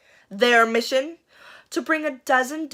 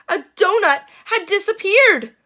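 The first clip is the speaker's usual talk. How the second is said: dramatic reveal; 10 semitones higher, 7 dB louder than usual. A narrator's reading. Dramatic reveal of missing donut.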